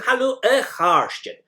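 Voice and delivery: "a Harshin" called out high and loud; bright call to a group, "Hello, a Harshin"; plural greeting. Shown as a demonstration. Teacher greets the class loudly, pronunciation model. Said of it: "speaking to more than one person".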